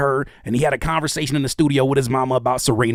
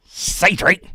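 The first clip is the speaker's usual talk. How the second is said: imitating [name]; 4 semitones higher, 4 dB louder than usual. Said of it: "looking at the camera ... and said".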